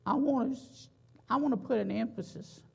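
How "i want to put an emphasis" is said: ordinary delivery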